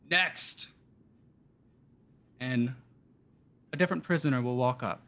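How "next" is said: barked call to the line. Acted. Clerk calling the next prisoner forward, called out.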